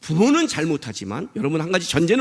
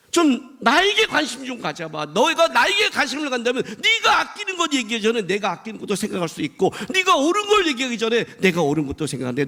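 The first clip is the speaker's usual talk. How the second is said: higher-pitched, pressing appeal; insistent reproach; 6 semitones higher than usual. Acted. God urges Jonah to consider him, insistent reproach. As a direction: argue earnestly, driving each point.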